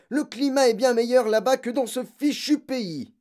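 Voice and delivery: loud, high-pitched rant; impatient father yelling; irritated shouting. A narrator's reading. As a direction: grumble at full volume. Father ranting while packing.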